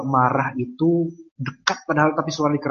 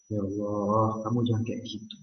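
much softer, close prayer as if on the phone; intimate soft prayer; 6 semitones lower, 10 dB quieter than usual. Retold or imitated. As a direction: murmur it close, like whispering to someone near. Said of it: "Itu rasanya kan dekat".

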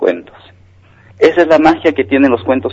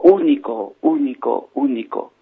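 receding cave voice; fading echo; 3 semitones higher, 6 dB quieter, slower than usual. Acted. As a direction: let each repeat die away. Tunnel echoes the father.

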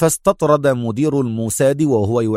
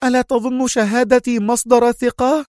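high, indignant question; lieutenant angrily challenging her chief; angry cry; 12 semitones higher than usual. A narrator's reading. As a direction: snap back, insulted. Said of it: "صاحت سونيا في غضب".

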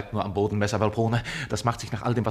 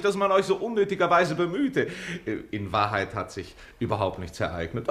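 ordinary talk

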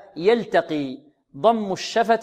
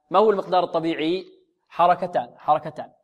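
normal talking voice

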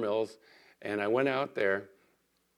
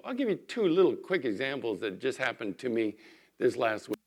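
usual speaking voice

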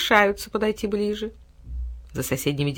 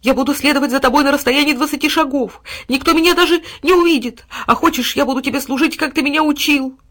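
high, louder begging dog; pleading dog; 8 semitones higher, 5 dB louder than usual. A narrator's reading. Dog pleading to follow at a distance, high and eager. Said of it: "принялся умолять мальчика Тилу".

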